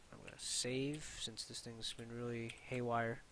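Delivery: ordinary talk, nothing marked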